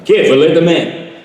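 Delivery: loud, pompous blowhard hero; Zapp Brannigan voice. Shown as a demonstration. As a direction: boast like a dim space captain. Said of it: "that was the voice of Zapp".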